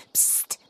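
soft sharp shush; hushing psst. Acted. Lilana hushing Kiesel, a soft shush. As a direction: hush her friend fast. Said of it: "sah sich furchtsam um".

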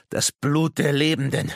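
high, loud, frenzied croak; cracking croak. Acted. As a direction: croak it out like a raving madman. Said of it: "seine Stimme überschlug sich". (irre krächzend, hoch und laut)

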